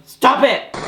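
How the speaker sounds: high, loud scold; real exasperated yell at the pet; scolding shout. Real, not acted. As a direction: yell the cat's name to stop it. Scolding cats mid-video.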